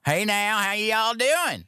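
high, drawn-out country twang; country accent. Shown as a demonstration. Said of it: "I guess country you can do".

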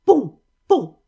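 two sudden loud bangs; gunshot imitation. A narrator's reading. Hunters firing at the wild geese, loud bangs. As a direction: boom out the gunshots, short and explosive.

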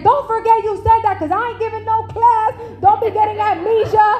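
a hint of a playful pastor warning her; teasing husband. Husband teasing his wife about her promise, lightly voiced.

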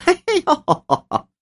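high, drawn-out mock chuckle; storyteller's acted derisive cackle; mocking laugh. A narrator's reading. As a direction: laugh scornfully in a slow, high voice. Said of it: "邓二奶奶笑道".

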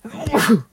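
loud sudden sneeze; a real full sneeze; sneezing. Real, not acted. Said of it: "I am about to sneeze".

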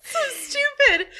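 high, squeaky laughing cry; giggly. Real, not acted. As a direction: crack up helplessly. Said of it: "I have the giggles so bad".

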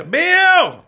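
high, loud shout for Bill; screaming. Acted. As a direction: scream her name across the fairground. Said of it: "Start screaming for Bill".

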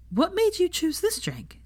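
high-pitched inquiring tone; curious question. A narrator's reading. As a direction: ask it with bright girlish interest. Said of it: "she asked Draco curiously".

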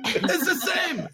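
very high, slow, laughing protest; high, slow laughing complaint; laughing exasperation. Retold or imitated. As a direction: plead through laughter that the words are identical. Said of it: "exasperated over the same word".

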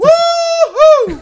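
huge high cheer; excited whoop. Pretend. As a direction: let out a wild whoop. Cheer at church joke.

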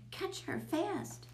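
high, slow mock scream; urgent high cry without much volume. A narrator's reading. Brian urges his neighbor to grab the cat, mild scream. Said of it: "Brian screamed again".

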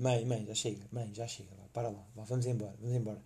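low embarrassed murmur to mother; hushed pleading. Retold or imitated. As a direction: quietly beg mom to stop. Quietly urging mother to stop.

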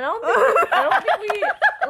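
high laughing words. Real, not acted. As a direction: laugh through it. Laughing while talking.

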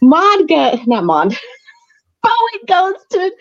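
giggly, high-pitched self-correction; real laughter breaking into the words; laughing. Real, not acted. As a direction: laugh as you fix your own slip. Laughs while correcting the wrong name.

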